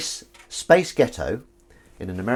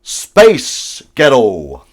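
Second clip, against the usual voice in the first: American accent joke; 12 dB louder, much slower than usual. Shown as a demonstration. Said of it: "I'll do it this once".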